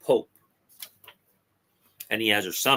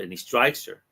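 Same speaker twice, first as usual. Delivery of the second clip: ordinary talk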